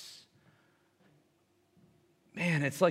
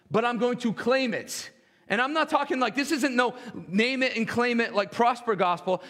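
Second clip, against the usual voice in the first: preaching; 5 semitones higher than usual. Acted. Worship pastor preaching to congregation.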